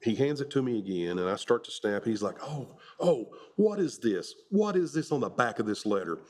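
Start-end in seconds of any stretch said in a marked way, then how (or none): 2.4-6.2 high, mocking feigned surprise. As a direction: ham up a gotcha discovery. Drill instructor mocking a recruit.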